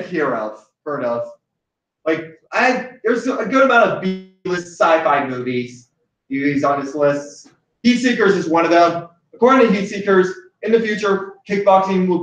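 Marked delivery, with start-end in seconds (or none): none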